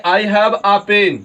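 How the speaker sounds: "I have a pen", stretched out word by word; very slow demo. Shown as a demonstration. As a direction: spell it out slowly so students hear "a". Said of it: "तो पढ़ेंगे इसको तो पढ़ेंगे". (बच्चों को समझाने के लिए बहुत धीरे पढ़ना)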